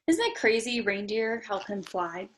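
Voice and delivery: plain reading voice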